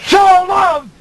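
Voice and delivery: sudden high shout on so; yelled. Shown as a demonstration. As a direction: yell out "so loved" at full force. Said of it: "yelling that out".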